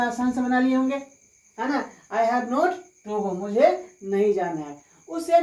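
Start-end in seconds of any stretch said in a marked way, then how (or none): 2.1-3.3 very slow, each word spelled out for students; slow dictation. Shown as a demonstration. Teacher dictating the English answer to students, very slowly. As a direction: say it slowly so students can write. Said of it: "teacher repeats sentence".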